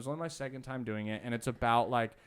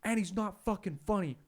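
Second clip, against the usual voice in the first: higher-pitched mock tirade; retold angry yelling; 6 semitones higher, 4 dB louder than usual. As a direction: imitate the furious bartender, hitting the end louder. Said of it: "I hear him yelling".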